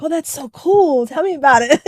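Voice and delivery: gushing mock reaction; imagined enthusiasm. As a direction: play an eager, delighted listener. Imagining an interested listener's eager reaction.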